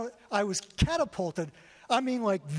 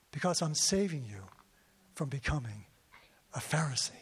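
voice of God; 7 semitones lower than usual. Retold or imitated. The Lord speaks to him.

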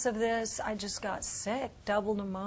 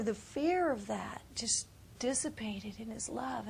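hushed unvoiced awe; whispering in awe of the vision; awed whisper; 6 dB quieter than usual. Real, not acted. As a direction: breathe it in a whisper. Hushed reflection.

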